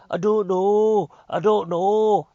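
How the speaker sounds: high, loud, drawn-out mock wail; imitating former self. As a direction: stretch it out high, hammed-up and clueless. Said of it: "I don't wanna talk like this".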